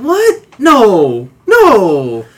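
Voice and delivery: loud, drawn-out falling no; long, swooping no of disbelief; mock dismay. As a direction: wail it in mock protest. Acted dismay at twist.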